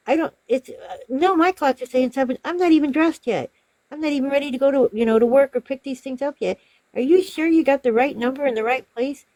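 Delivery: high, protesting mimicry of a customer; imitated arguing customer. Customer not yet dressed for work disputes the time, arguing. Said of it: "she kept arguing with him and".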